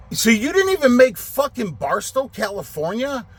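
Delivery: high-pitched incredulous question; angry disbelief. Retold or imitated. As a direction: re-enact rising anger at the driver. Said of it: "Now I'm fucking getting angry".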